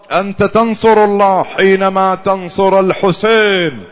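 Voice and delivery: loud, high, rising sermon cry; fiery preaching. Acted. Preacher urging the mourners, loud and climbing. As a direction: drive the point home to the crowd.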